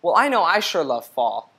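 ordinary delivery, nothing marked

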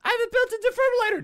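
put-on high, fast robot girl voice; chirpy malfunctioning robot girl; jerky animatronic voice. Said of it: "I wanna be Malfunction Robot Girl".

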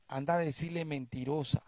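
soft, hushed command; a calm inner voice giving an order; quiet voice of God. Retold or imitated. Holy Spirit tells the deacon what to say, hushed. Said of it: "el Espíritu Santo le dijo".

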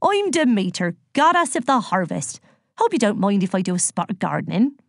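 breezy farm-woman goddess voice; Demeter, jolly. Acted. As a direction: introduce yourself with rustic good cheer.

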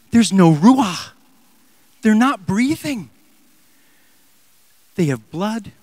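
ordinary talk, nothing marked

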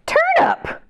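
very high, bright shout of the answer; excited reveal. Acted. Reader revealing the turnip to children, excited. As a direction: pop the answer out.

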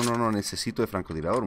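normal talking voice

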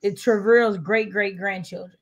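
ordinary talk

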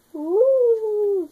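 high, loud wolf howl; playful wolf howl. Shown as a demonstration. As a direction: throw back your head and howl. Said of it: "how to bark and howl".

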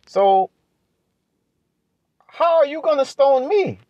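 high-pitched fervent preaching. Acted. Preacher retells Jesus' reply, rising.

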